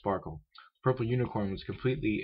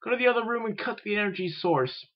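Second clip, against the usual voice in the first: high-pitched, urgent command; urgent shouted order; 13 semitones higher than usual. A narrator's reading. Twilight ordering her helper to cut the power, urgent shout. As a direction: give the order in a high, alarmed voice. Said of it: "Twilight shouted".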